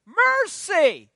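high, loud desperate plea; crying out. Retold or imitated. Preacher showing the church how to cry to God, loud plea. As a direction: call out for help with everything. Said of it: "cry out to God".

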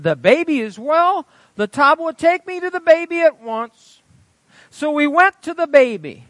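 high, loud pulpit storytelling; full-force preaching narration. A narrator's reading. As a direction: proclaim the story to the whole congregation. Reads testimony at full force.

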